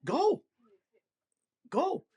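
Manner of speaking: retold prompt, pitched well up; urging command. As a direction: coax him onward with a high, short word. Retells urging creature to leave.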